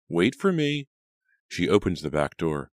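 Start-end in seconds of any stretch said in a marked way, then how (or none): 0.1-0.9 high call, a bit louder. A narrator's reading. Mother calling her daughter in the night, higher and louder. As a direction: reach out to someone far away. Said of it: "she called a little louder".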